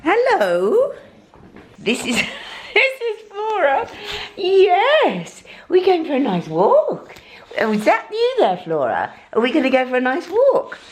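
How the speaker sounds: high, excited sing-song dog talk. Real, not acted. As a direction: coo at the puppy, pitch way up. Greeting the dog Flora.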